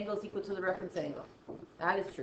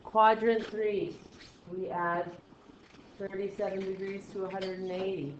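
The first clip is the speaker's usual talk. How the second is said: even tone with long gaps between phrases; slow, uninflected talk. Shown as a demonstration. Teacher adding degrees for class, slow and uninflected. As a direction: hold the pitch level and take it slowly. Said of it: "I'm going way too slow".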